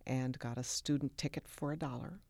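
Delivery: usual speaking voice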